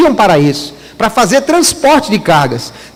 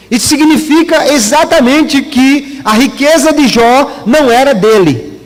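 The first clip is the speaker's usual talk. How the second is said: ordinary delivery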